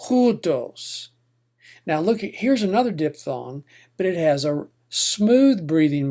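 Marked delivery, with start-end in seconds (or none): 0.1-1.1 hutos stressed on HU; hutos, HU raised and stressed; stress on first syllable. Shown as a demonstration. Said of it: "Say that a few times out loud".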